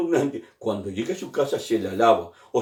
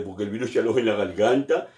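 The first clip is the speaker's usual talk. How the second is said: ordinary delivery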